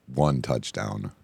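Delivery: ordinary delivery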